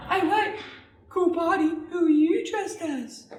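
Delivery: put-on high-pitched, friendly party girl; high, curious sock girl; sock puppet girl. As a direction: chat up a fellow guest in a high girl's voice.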